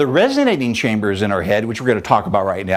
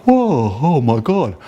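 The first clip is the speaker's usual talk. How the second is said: blocked-nose voice; slower than usual. Shown as a demonstration. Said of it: "talk like you have a cold".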